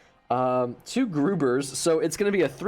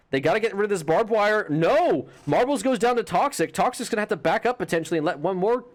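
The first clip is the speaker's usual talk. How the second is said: rising excited play-by-play, higher; esports caster; 3 semitones higher than usual. Real, not acted. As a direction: hype caster calling the clutch. Live play-by-play of the round.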